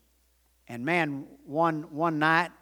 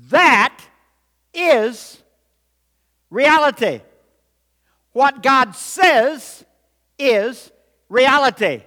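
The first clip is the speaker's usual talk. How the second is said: loud, high preaching, word by word; emphatic proclamation; 8 semitones higher, 9 dB louder, slower than usual. Real, not acted. Preacher proclaiming God's word to congregation, loud and deliberate. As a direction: drive each word home at full voice.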